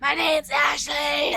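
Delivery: slow, high, bellowed name; hammed-up shout. Hamming up the character's name.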